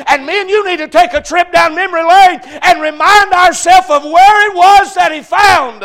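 loud, high, rising preaching climb; full-force preaching. Acted. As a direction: build the sermon toward its peak.

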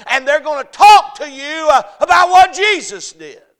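high, loud climactic sermon cry; fiery revival preacher at the top of his voice; full-force preaching. Acted. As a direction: build it to a shouting peak.

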